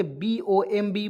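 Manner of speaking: ordinary talk, nothing marked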